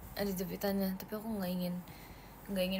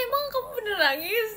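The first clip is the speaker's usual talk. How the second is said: much higher and louder, squealed question; teasing squeal; 13 semitones higher, 7 dB louder than usual. Real, not acted. To Mikaela, teasing her about crying, squealing. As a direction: squeal it in playful disbelief.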